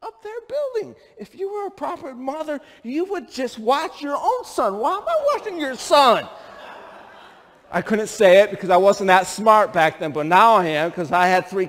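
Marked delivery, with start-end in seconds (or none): 0.0-6.3 indignant retort. Retold or imitated. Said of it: "I'm like".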